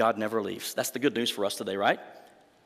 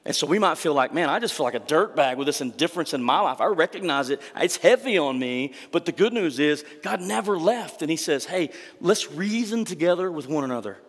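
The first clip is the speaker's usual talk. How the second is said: raised, higher preaching voice that builds; impassioned preaching; 4 semitones higher, 4 dB louder than usual. Acted. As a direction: lift the congregation with fervent preaching. Preaching at full force.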